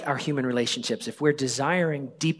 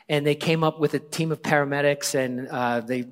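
ordinary talk, nothing marked

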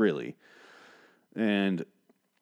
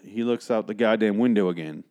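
ordinary talk

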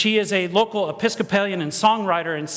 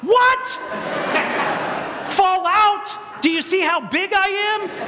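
mock disbelief; 9 semitones higher than usual. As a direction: act out being appalled by the answer. Acts out disbelief at the attendant.

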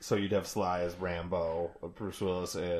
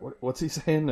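mock confused question; 8 semitones higher, 5 dB louder than usual. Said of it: "Lee's like".

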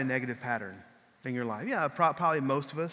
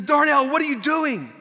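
loud, higher angry coach yell; coach hollering; 8 semitones higher, 7 dB louder than usual. Retold or imitated. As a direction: bark at him like an angry coach. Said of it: "he starts hollering at me".

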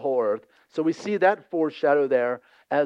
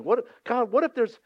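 bargaining plea; 8 semitones higher than usual. Acted. Abraham bargaining with God.

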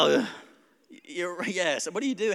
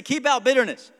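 louder call-out; 8 semitones higher, 10 dB louder than usual. Shown as a demonstration. Pastor leading the church in a louder repeat.

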